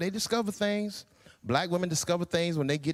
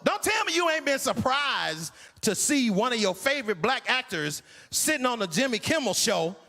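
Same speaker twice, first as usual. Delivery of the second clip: ordinary talk, nothing marked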